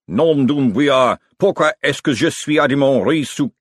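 shouted French, at full pitch; screamed French curse. A narrator's reading. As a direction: blurt it out in a French rage. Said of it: "followed by a scream of".

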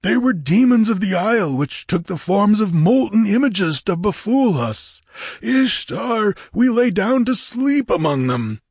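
high, urgent pirate voice; high-pitched, insistent pirate tale; alarmed corsair. A narrator's reading. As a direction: plead your case as a frightened corsair. Corsair tells of demons.

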